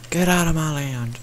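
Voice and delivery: hammed-up angry yell; mock angry shout. Real, not acted. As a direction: bark it at the mob. Chasing a mob in game.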